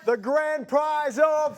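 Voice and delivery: slow, drawn-out prize build-up; booming prize announcer. Acted. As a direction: stretch it like a game show host.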